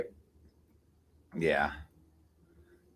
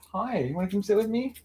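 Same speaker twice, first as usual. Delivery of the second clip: high coaxing pet voice; 8 semitones higher, 3 dB quieter than usual. Real, not acted.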